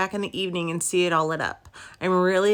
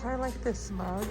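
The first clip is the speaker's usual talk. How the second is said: muffled voice; 7 dB quieter than usual. Real, not acted. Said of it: "sorry I sound muffled. Mask's inside".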